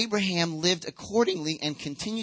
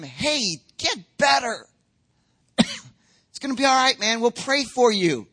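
raised, hearty brush-off; mock encouragement; 7 semitones higher, 6 dB louder than usual. Churchgoer brushing off someone in great need, mock encouraging. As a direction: send them off with empty cheer. Acting empty comfort to needy.

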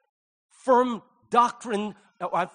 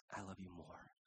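tender; 12 semitones lower, 24 dB quieter than usual. Pretend. God's reply, voiced softly.

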